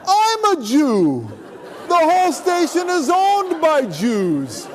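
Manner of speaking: very high, loud, drawn-out exclaiming; overjoyed. Retold or imitated. Station man to Mark, thrilled to offer Shabbat off, hammed-up joy. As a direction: crow it slowly with comic delight.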